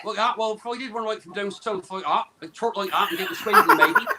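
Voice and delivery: comic southern English farmer accent; hammed-up rustic farmer accent; West Country farmer accent. Shown as a demonstration. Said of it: "how do you do a farmer?".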